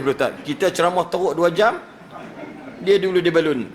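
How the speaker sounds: ordinary delivery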